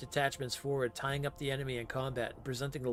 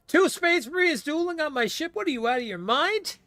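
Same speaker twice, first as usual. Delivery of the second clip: high, loud incredulous outburst; outraged disbelief; 9 semitones higher, 5 dB louder than usual. Acted. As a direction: scold them in disbelief. Outraged captain.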